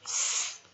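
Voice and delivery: S as a long voiceless "sss"; isolated sound. Shown as a demonstration. Teacher showing how soft C sounds, S held as "sss". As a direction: hold S as a pure consonant, no vowel. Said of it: "都可以念".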